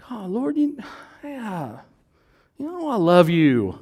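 high-pitched wounded protest, swelling at the end; hurt Peter. Acted. Peter protests his love to Jesus, acted hurt. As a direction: plead with hurt feelings, voice pushed up high. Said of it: "Bible says he got his feelings hurt".

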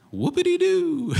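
high, squeaky sarcastic whoopity-doo. Pretend. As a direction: toss off a sarcastic fake cheer. Mocking the audience's reaction.

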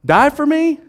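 high, loud challenging question; Jesus asking. Acted. Jesus challenging Pete, loud and high.

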